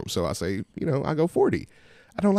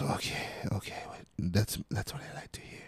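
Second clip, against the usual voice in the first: whispered imitation of a pleased haggler; hushed, self-satisfied customer voice; 10 dB quieter than usual. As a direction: say it under the breath, quietly satisfied.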